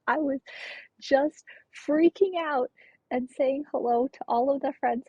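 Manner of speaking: usual speaking voice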